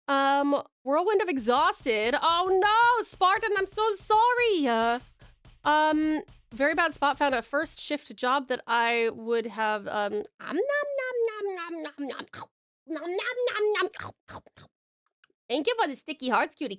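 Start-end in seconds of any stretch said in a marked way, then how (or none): 2.2-4.7 high, louder sorry; dismayed sympathy. Real, not acted. Sympathy for viewer's bad news. 11.0-13.9 high chomping nom noises; munch it out like a cartoon; playful nom noises.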